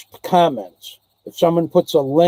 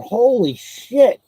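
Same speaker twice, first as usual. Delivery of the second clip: drawn-out, higher-pitched "holy shit" of astonishment; retold amazement; 6 semitones higher, much slower than usual. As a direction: relive the moment it clicked who he was, slow and raised. Retold meeting an old acquaintance.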